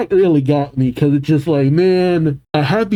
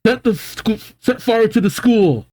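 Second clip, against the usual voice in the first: higher, pained command; mock cry out; 8 semitones higher than usual. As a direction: force out the painful order. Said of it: "Jarl cries out".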